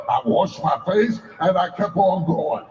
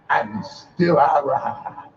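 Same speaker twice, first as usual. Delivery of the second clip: rhythmic call, slow on the refrain; preaching refrain; 4 semitones lower than usual. Acted. Preacher leading a rising refrain, measured. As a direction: preach each line, then land the refrain slowly.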